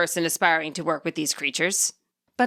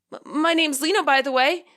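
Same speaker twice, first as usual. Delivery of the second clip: shy introduction; 7 semitones higher than usual. A narrator's reading. Lino introduces himself.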